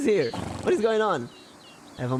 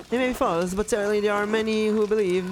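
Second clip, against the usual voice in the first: voiced game character; 6 semitones higher than usual.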